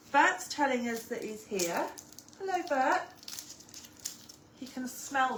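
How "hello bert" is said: high sing-song hello; talking to a dog. Real, not acted. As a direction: greet the dog brightly. Greeting the dog.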